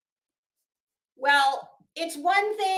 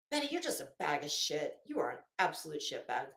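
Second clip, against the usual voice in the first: much lower, softer, fast contemptuous mutter; contemptuous aside; 10 semitones lower, 8 dB quieter, faster than usual. Real, not acted. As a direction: toss the insult off under your breath. Insulting Betty under her breath.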